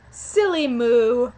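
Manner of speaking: loud, drawn-out playful jibe; slow, emphatic pretend name-calling; mock insult. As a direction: stretch SILLY MOO out loudly. Performs the insult, then confirms it.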